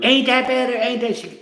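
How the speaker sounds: high-pitched mock nagging; nagging girlfriend. Replaying the girlfriend from the skit, nagging. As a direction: mimic her nagging up high. This girl comes in.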